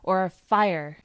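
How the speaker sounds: loud, slow, drawn-out command; shouted warning. A narrator's reading. Cousin chasing the narrator with a warning, shouting. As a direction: call out a halt as a threat. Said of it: "He cried".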